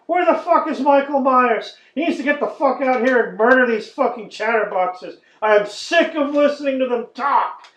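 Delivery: high, loud exasperated rant; fed-up rant. Retold or imitated. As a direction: vent your boredom at the screen. Bored viewer ranting at movie.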